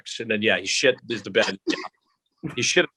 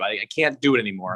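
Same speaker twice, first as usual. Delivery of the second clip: usual speaking voice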